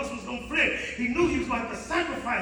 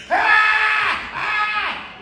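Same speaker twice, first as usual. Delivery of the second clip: high, loud imitated scream; anguished scream; 11 semitones higher, 12 dB louder than usual. Driver after hitting his son, imitated loud screams. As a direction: scream out in horror. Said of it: "he's still screaming".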